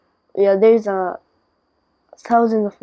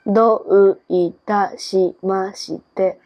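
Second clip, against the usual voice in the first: slow, syllable-by-syllable どういたしまして; slower than usual. Shown as a demonstration. Japanese speaker demonstrating for a learner, slow. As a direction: draw out every sound of どういたしまして. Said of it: "Say it slow".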